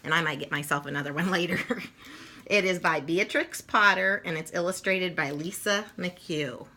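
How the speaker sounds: ordinary delivery, nothing marked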